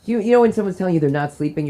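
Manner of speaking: normal talking voice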